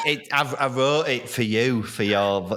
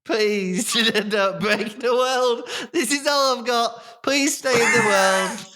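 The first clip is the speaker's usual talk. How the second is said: higher, laughing plea to stay in character; half-laughing plea not to break the scene; 11 semitones higher than usual. Real, not acted. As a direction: beg through laughter to keep the bit going. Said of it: "laughing pleading with co-host".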